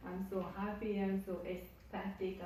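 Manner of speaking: usual speaking voice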